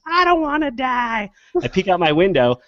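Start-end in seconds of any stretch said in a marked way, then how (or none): 0.1-1.3 high, slow mock wail; stretched, high-pitched imitated scream; retold scream. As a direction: imitate a drawn-out cry for mercy. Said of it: "Just sc-, screaming".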